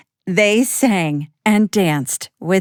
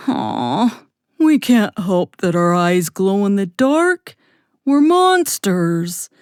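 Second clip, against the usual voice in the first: friendly monster voice; 4 dB louder than usual. Acted. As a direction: play the gentle monster, a bit hurt. Monster Clyde voiced in story.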